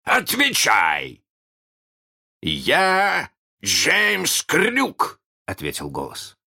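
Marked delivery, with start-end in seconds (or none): 0.0-1.2 drawn-out, higher demand; loud, imperious cry; shouted command. A narrator's reading. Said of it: "закричал он". 2.4-5.2 high, very slow proclamation; mock-captain boast; imitating Hook's voice. A narrator's reading. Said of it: "его же голосом".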